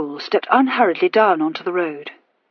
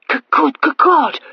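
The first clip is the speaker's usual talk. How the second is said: higher, louder frightened outburst; young wife crying out in fear at the door; alarmed cry; 8 semitones higher, 4 dB louder than usual. A narrator's reading. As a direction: stammer it out in sudden panic. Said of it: "she cried".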